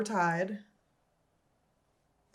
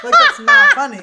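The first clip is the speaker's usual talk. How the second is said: high, loud laugh through it; 14 dB louder than usual. Real, not acted. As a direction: crack up while saying it. Cracking up at story.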